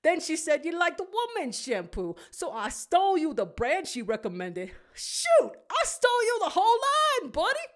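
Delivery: a hint of a creaky old walrus; Willy the Walrus. A narrator's reading. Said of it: "hear Willy the Walrus".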